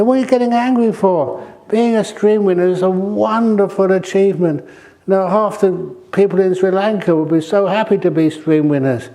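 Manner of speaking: exasperated retort at the teachers; mock angry retort. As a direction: play the offended mother scolding. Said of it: "she got really angry".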